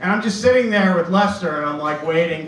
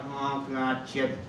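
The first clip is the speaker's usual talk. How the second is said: weary mom; 5 semitones lower, 11 dB quieter, much slower than usual. Retold or imitated. Mom's exasperated reaction.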